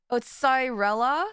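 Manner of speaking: ordinary talk, nothing marked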